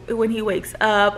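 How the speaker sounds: ordinary talk, nothing marked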